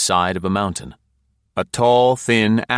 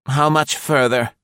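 higher, louder impatient question; impatient wife; 7 semitones higher, 4 dB louder than usual. Acted. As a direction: push the question, tired and impatient. Linda asks impatiently.

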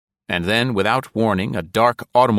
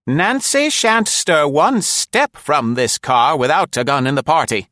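put-on an old woman who won't be argued with; high elderly voice, firm and deliberate; firm old lady; 9 semitones higher, 5 dB louder than usual. A narrator's reading. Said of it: "Nora said firmly".

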